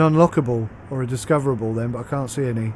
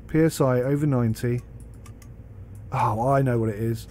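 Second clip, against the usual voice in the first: ordinary delivery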